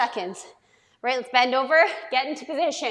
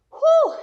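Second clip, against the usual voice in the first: relieved whew; 14 semitones higher, 5 dB louder than usual. Real, not acted. Exhale after hard push-up set.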